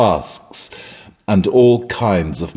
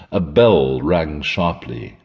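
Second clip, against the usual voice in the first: usual speaking voice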